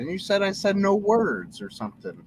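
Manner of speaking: high, loud mock-outraged protest; loud playful indignation; indignant protest. Real, not acted. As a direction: push back, half laughing, at the friend. Protesting friend's teasing.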